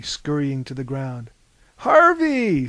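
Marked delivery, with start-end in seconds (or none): 1.8-2.7 high, loud cry of recognition; surprised cry. A narrator's reading. Prometheus, regaining his senses, greets Harvey loudly. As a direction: call the name out, amazed. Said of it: "said Prometheus".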